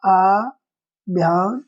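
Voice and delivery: आभ्यंतरिक said very slowly, long आ at the start; drawn-out correct word. Shown as a demonstration. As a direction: stretch the first आ of आभ्यंतरिक. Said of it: "अ की जगह आ होता है". (आभ्यंतरिक को धीरे, लंबे आ के साथ)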